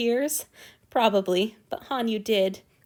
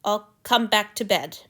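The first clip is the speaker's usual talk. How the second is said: plain reading voice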